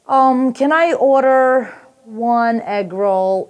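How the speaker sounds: very slow, drawn-out ordering; mock leisurely order, stretching each word; dawdling customer. American customer orders at a busy takeout, painfully slow. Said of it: "takes his time".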